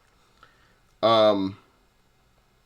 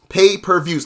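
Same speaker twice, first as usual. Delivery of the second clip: ordinary delivery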